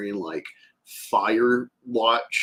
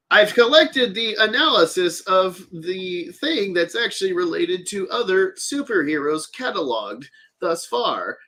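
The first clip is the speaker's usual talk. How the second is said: android voice; 9 semitones higher, 3 dB louder than usual. Acted. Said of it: "I like to read like that".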